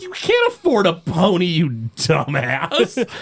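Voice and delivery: mock scolding wife voice, slow on the insult; Marge, exasperated. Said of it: "And Marge is like".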